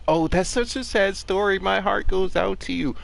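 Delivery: 1 mock sympathy. Mocking empty sympathy.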